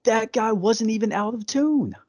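high-pitched disbelief, stretched out; pitched far up in disbelief; incredulous. Real, not acted. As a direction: exclaim it way up high, as an outrage. Revealing the injustice of the firing.

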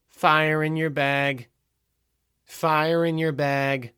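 slow, drawn-out public call. A narrator's reading. Store clerk calls down the line.

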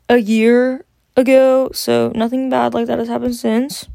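normal talking voice

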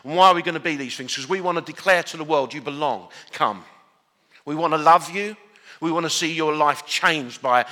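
rally the congregation like a battle cry; preaching war cry. Real, not acted. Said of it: "the war cry of hospitality".

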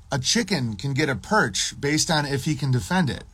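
usual speaking voice

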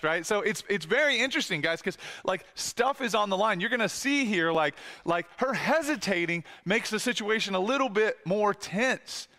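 usual speaking voice

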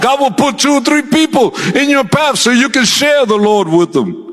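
high, fervent preacher's exhortation; full-force pulpit exhortation; preaching at full force. Real, not acted. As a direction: exhort the church, voice lifted high.

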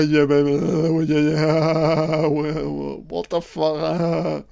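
loud, high, wobbling cries; mock crazed wailing. Viewer losing his mind at the film, mock crazed wail. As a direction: wail the words as if tripping out.

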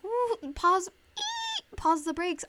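squealing high in shock, then braking hard; high startled squeal, pump the brakes; mock alarmed squeal. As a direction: yelp like you just saw the bill. Shock at surprise price.

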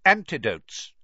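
a touch of commanding schoolmaster; teacher calling order. A narrator's reading. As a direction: announce it firmly to the class. Snape addresses his class.

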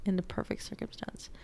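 hushed, breathy aside; whispered. Acted. To viewers, whispering the exam secret. Repeats the test-taking key phrase.

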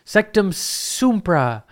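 high, slow mock incantation; imitating Snape casting. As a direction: drag out the spell like a wizard testing it. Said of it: "Snape was like".

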